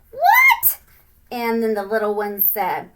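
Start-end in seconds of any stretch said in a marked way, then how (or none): 0.1-0.8 shocked what. Retold or imitated. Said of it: "And they were like".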